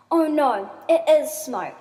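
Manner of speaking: acted alarm; alarmed realisation. A narrator's reading. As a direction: realise the danger out loud. Character realises house is burning.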